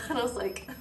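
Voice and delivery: high-pitched talking through laughter; laughing. Real, not acted. Mother recalling being overheard in the bathroom, laughing. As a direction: crack up while telling the story.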